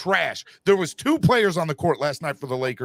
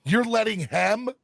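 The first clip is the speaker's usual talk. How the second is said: angry yelling; 7 semitones higher than usual. Real, not acted. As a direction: yell at the player in disbelief. Yelling at Anthony Davis.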